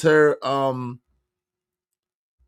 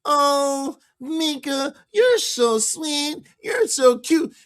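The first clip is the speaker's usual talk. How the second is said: higher, slow, nerdy mock cooing; Urkel-style sweet-talk; 8 semitones higher, slower than usual. Host mocks the guest's Anton quote as Urkel, high and slow. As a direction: coo like a lovesick Urkel. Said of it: "Anton ain't Urkel".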